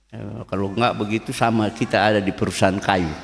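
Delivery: ordinary talk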